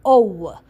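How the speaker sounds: "O" given as a full oh; long O sound. Shown as a demonstration. Teacher modelling the ow sound. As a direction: model "O" for the ow blend. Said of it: "together as one sound also sounds like".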